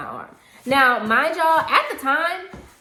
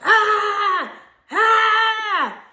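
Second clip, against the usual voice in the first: loud, high imitated scream of rage; two explosive "Ah!" screams; enraged yell; 12 semitones higher, 9 dB louder than usual. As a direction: yell out as if losing it. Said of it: "this man loses it. He goes".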